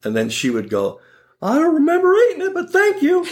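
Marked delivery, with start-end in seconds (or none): 1.4-3.2 put-on very high, quick old-lady voice, ending in a laugh; confused grandma. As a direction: chirp it like a sweet, muddled old grandma.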